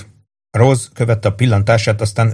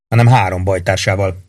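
usual speaking voice

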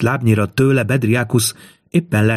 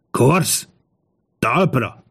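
restrained shout; 4 semitones higher, much slower than usual. A narrator's reading. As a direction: call out the command, holding the volume back. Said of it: "amilyen hangosan csak merte, elkiáltotta magát".

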